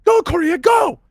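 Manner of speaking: very high, loud desperate shout; Corporal Macron shouting. Acted. Game master voices a corporal urging a marine to flee, shouting. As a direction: yell to drive a comrade onward. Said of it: "he just keeps shouting".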